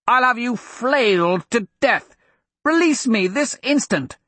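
a spoiled royal barking orders from captivity; furious prince shouting. Acted. Bound prince shouting at captor.

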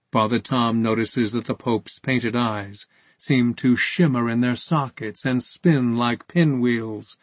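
ordinary delivery

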